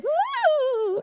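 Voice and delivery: high drawn-out "woo"; high-pitched whale call. Retold or imitated. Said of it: "like high pitched".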